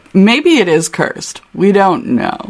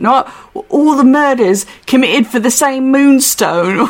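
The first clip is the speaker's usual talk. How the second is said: higher-pitched put-on British accent; London accent, pitched up; Hutch accent; 5 semitones higher than usual. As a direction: stumble through it in an English accent. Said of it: "what my Hutch accent is gonna sound like".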